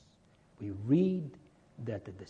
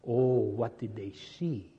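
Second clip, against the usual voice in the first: ordinary talk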